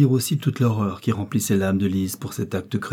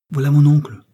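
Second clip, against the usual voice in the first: louder, higher sudden warning; boy's sudden startled announcement; sudden alert; 5 semitones higher, 8 dB louder than usual. A narrator's reading. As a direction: call it out all at once. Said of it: "dit tout à coup Sacha".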